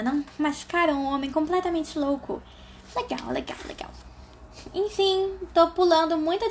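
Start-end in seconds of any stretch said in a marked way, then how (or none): 2.9-3.9 whisper. Real, not acted. Unvoiced aside.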